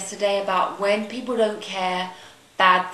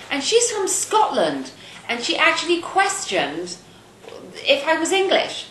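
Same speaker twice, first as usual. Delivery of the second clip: indignant; 8 semitones higher than usual. Real, not acted. Outraged at being questioned.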